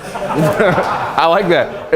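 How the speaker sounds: slow laughing brush-off; dismissive laugh. Retold or imitated. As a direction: laugh it off without answering. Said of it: "And Owen goes like this".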